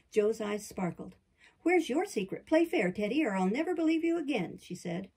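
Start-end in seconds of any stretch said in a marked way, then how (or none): 1.6-4.6 higher and louder, pressing eagerly; an excited girl pushing a friend to share; eager demand. A narrator's reading. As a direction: insist he play fair, voice lifted. Jo eagerly demands Laurie's secret.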